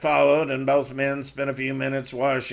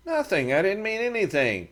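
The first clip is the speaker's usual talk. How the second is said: higher voice, quick denial; a hint of sheepish Matt; Matt, backpedaling; 7 semitones higher than usual. A narrator's reading.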